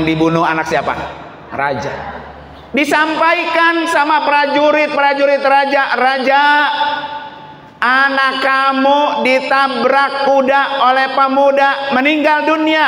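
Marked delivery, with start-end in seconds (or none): 2.8-12.8 high-pitched, urgent announcement from the pulpit; preaching. Acted. Guards tell the king his son is dead, preaching. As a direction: report it in a high, carrying preacher's voice.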